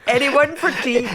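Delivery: high, loud posh English tea voice; posh Surbiton accent. Retold or imitated. Guest hamming up posh Surbiton, posh English accent. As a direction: offer tea like a genteel lady. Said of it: "the Surbiton version".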